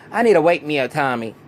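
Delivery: the pushy mom wants her hit now; a hint of a loud demanding mother; retold demand.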